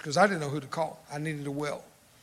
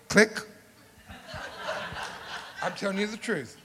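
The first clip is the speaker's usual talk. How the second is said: usual speaking voice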